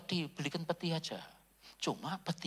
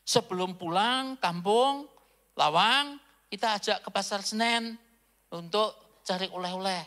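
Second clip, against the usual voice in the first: brother-in-law's cheerful promise; 5 semitones higher, 7 dB louder than usual. Retold or imitated. Brother-in-law promising the pastor a market trip, loud and eager. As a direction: call it out brightly to the visiting pastor. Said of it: "dikasih janji sama iparnya".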